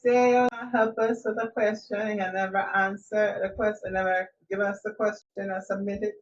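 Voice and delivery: scolding Jamaican mama, starting high and loud; mock-stern mama telling-off. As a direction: tell them off like a fed-up mother, easing down to a pointed "Okay?". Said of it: "That's her mama voice".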